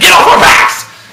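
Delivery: drawn-out high, loud shout; exasperated yell. Acted. Man telling women to stop asking, yelling. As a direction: scream it as the rant's climax.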